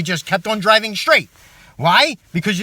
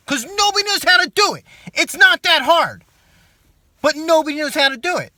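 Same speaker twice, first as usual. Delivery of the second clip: angry rant; 7 semitones higher, 4 dB louder than usual. Acted. Ranting about merging.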